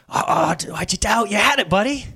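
encouraging. Shown as a demonstration. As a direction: urge him to keep going, gently. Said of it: "That's the posture I read it".

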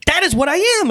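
high, bright cry of joy; joyful exclamation. Acted. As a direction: exclaim it in delight. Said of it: "exclaimed the former thief".

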